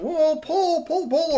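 very high squeaky pull pull; urgent squeaky heave-ho; squirrels straining. Acted. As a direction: squirrels hauling the toy, high and frantic. Squirrels heaving.

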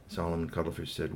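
usual speaking voice

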